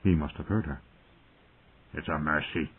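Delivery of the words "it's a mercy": low, soft, sullen killer; hushed, lowered villain's voice; muttering intruder. A narrator's reading. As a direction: murmur it low and cold. Sour intruder justifying a killing.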